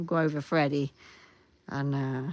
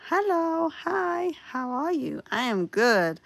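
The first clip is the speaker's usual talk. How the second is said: cute high greeting voice; 12 semitones higher, 5 dB louder, slower than usual. Acted.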